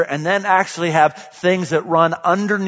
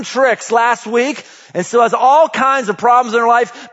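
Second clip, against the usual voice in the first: raised, driving preacher's voice; preaching at full force; 6 semitones higher, 4 dB louder than usual. Acted. Pastor rousing the church about change, full-force preaching. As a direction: push it out to the whole congregation.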